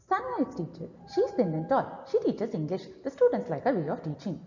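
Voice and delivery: rushed, fast reading; gabbled fast reading aloud; too fast. Shown as a demonstration. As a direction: race through the lines too quickly. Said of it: "this is the fast pace you need to avoid".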